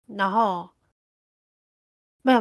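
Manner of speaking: ordinary talk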